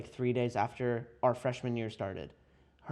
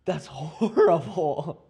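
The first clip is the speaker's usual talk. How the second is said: drawn-out, laughing disapproval; real laugh breaking into the words; amused horror; 3 semitones higher, 6 dB louder, much slower than usual. Real, not acted. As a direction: stretch it out through a laugh, louder. Appalled laughing at friend's spoiler prank.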